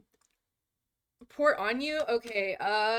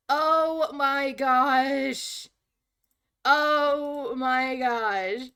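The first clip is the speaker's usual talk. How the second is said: slow drawn-out disbelief; oh my gosh, stretched out slowly; stunned; 3 semitones higher, 4 dB louder, much slower than usual. Real, not acted. Shocked friends were unheard.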